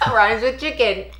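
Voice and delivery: high-pitched, giggly echo; laughing. Real, not acted. Wife teases husband over the rhyme, laughing. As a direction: repeat it while cracking up. Cracking up at his rhyme question.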